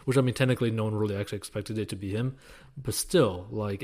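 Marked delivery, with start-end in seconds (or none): none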